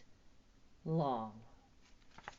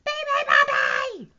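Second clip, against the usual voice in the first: very high, loud seal shriek; piercing animal screech; 16 dB louder than usual. A narrator's reading. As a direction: squeal it out like a seal. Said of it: "shrieked the seals".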